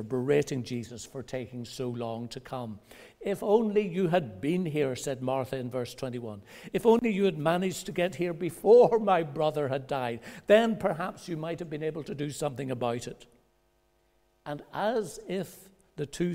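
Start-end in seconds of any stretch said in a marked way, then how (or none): 3.2-5.0 loud reproachful cry; berating Jesus. Retold or imitated. Martha scolding Jesus for coming late. 6.7-13.3 rebuking. Retold or imitated. Martha berating Jesus for coming too late, loud.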